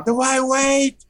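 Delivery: high, drawn-out yell over the leaf blowers; mock yell over the roar; yelling over noise. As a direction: shout the question over deafening noise. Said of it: "I yell at Guillermo".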